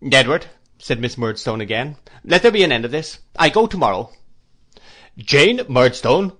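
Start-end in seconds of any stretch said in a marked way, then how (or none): 0.1-0.5 loud, sharp call by name; insistent ultimatum. A narrator's reading. Sister demands brother's attention, insistent. 2.2-4.1 loud, higher, emphatic declaration; insistent ultimatum. A narrator's reading. Sister threatens to leave tomorrow, insistent. 5.2-6.3 loud, slow, raised-pitch command; thundering. A narrator's reading. Brother silences his sister, thundering. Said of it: "thundered Mister Murdstone".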